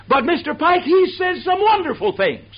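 high, breathless gushing praise; gushing fan. Retold or imitated. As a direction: gush over the preacher like an eager fan. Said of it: "without fail".

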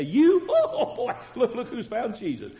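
high, laughing scoff at her claim; mocking laugh. Town men laughing off the woman's news, high mocking scoff. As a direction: cackle at the very idea, voice shooting up.

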